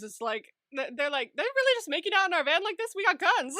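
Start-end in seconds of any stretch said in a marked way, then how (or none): 1.4-3.5 high, loud mock disbelief; confused guards. Guards react to kissing in their van, confused. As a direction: voice baffled guards, incredulous and loud. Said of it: "the guards are confused".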